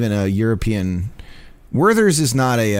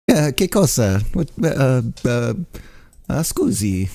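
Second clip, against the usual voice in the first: mock Italian accent. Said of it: "that's all my Italian that I have".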